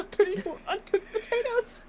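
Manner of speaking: cracking up through the words; laughing. Real, not acted. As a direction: laugh it out, high and giddy. Bursts out laughing mid answer.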